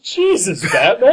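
high, startled gasp of disbelief; shocked exclamation. Retold or imitated. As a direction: blurt it out in shock. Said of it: "She's like".

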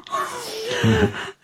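squealing, half-crying laughter; high helpless laugh. Real, not acted. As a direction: laugh till it nearly turns to tears. Laughing at own awkward story.

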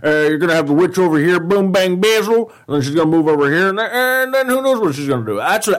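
high, excited football commentator; John Madden impression. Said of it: "John Madden's more up here".

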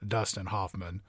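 low, soft Morgan Freeman voice; lightly voiced Freeman narration; a hint of a deep calm narrator; Morgan Freeman impression. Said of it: "I'm Morgan Freeman".

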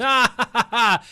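high, loud real guffaw; loud burst of laughter. Real, not acted. Cracks up at the verse.

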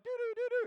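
high sing-song doo-doo; dangling legs noise. Retold or imitated. Preacher miming his friend's legs swinging, high sing-song. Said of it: "His little legs were like".

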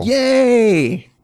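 loud high yay; cheering yay. Real, not acted. Cheering a right answer.

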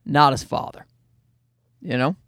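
usual speaking voice

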